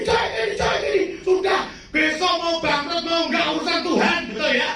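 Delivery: fervent preacher testimony; preaching at full force. Real, not acted. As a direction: proclaim it with fervour.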